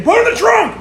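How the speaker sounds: high, loud barked order; cop yelling. Retold or imitated. Cop orders the freezing kid, retold yell. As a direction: bark the order at him.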